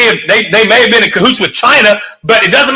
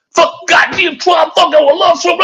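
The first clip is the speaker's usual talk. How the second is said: much higher, hammed-up ranting Trump fan; mocking angry audience member; 10 semitones higher, slower than usual. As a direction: mimic a heckler losing it over Trump, pitched way up. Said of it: "knee-jerk reaction from some folks in the audience".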